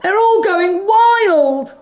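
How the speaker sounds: alarmed cry; nurse's shout. Acted. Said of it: "shouted the nurse".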